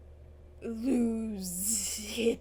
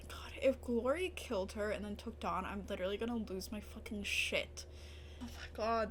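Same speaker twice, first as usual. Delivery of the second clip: softer whispered worry; worried whisper; 4 semitones lower, 6 dB quieter than usual. Real, not acted. Viewer fearing Dawn was taken, hushed whisper. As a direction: breathe the fear out quietly.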